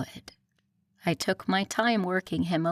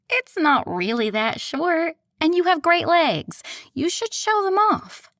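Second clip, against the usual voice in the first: much higher, bright insistent friend; bubbly friend voice; 10 semitones higher, 6 dB louder than usual. Acted. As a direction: play the bossy best friend. Evie insisting, voiced high.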